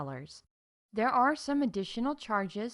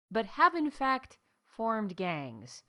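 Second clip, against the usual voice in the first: slow dramatic reveal; slower than usual. Acted. Dramatic reveal of bird gangs.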